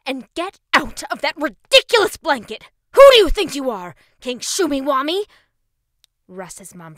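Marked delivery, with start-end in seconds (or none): 0.1-5.2 loud breathless mom yelling; angry scolding. A narrator's reading. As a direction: scold him between gasps. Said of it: "gasping for air".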